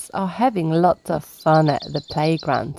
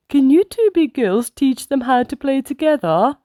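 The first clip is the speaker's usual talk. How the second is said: higher, gently asking mother; Mummy Pig asking; 7 semitones higher than usual. Acted. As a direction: ask her son nicely to share. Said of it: "asks Mummy Pig".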